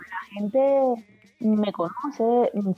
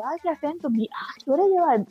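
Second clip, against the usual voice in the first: higher-pitched quote of admirers; retold delighted remark; 7 semitones higher than usual. People remark on her Caribbean accent, retold delighted exclamation. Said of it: "me lo han dicho".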